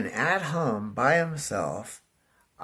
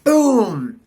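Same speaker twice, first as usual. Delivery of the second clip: much higher, loud mock crash; loud impact boom; 11 semitones higher, 12 dB louder than usual. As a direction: slam the word out like the bike hitting the hole. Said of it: "I said".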